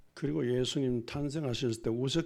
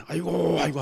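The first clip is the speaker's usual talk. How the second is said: a light mock 아이고 cry, louder; mock wail; 4 semitones higher, 8 dB louder than usual. Shown as a demonstration. Pastor showing what mourners should cry, mock wailing. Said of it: "어디".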